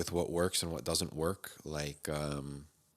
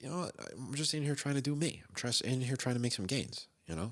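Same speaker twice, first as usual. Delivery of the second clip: annoyed; 7 semitones higher than usual. Retold or imitated. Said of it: "So I got pissed off. I was like".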